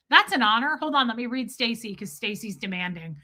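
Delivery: normal talking voice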